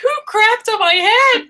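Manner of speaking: high, loud indignant yelp; mock outraged cry. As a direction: cry out as if hit from above. Acting shocked theater victim.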